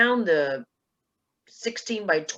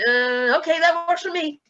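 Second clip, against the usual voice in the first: pleased surprise; 11 semitones higher, 3 dB louder than usual. Retold or imitated. Pleased with new intro.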